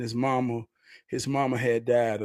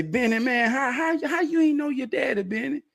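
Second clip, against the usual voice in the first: astonished; 11 semitones higher than usual. Retold or imitated. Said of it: "And I say".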